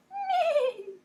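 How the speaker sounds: very high whinny; a goose doing a very high horse neigh; silly goose neigh. Acted. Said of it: "the goose says".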